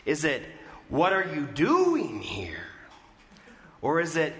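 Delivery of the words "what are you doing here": DOING stretched out and raised, slower phrase; stress on DOING. Shown as a demonstration. Preacher testing where a question's emphasis falls, stress on "doing". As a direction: lean long and hard on "doing". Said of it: "Where's the emphasis in that question?".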